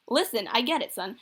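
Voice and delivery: plain reading voice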